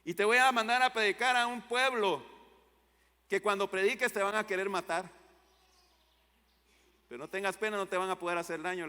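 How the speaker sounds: a booming divine call to the prophet; voice of God. Acted. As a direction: proclaim it as God commanding the prophet. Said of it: "Dios le llama a Jeremías y le dice".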